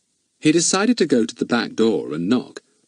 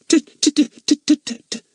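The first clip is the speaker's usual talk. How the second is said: high, loud pretend stammer; 4 dB louder than usual. A narrator's reading. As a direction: stammer as if scared. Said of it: "he stammered".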